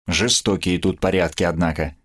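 usual speaking voice

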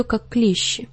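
ordinary delivery, nothing marked

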